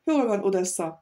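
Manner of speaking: ordinary delivery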